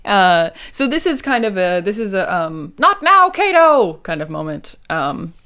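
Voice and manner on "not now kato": high, loud mock protest; hammed-up cry to an attacker; exasperated shout. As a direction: yell it high, fending someone off. Imitating Clouseau fending off Kato.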